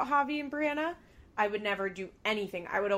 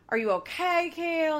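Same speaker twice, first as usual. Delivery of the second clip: raised, louder mock-concerned question; 8 semitones higher, 4 dB louder than usual. Podcaster imitating a producer asking a cast member, mock concern. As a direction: lightly imitate someone checking on an upset person.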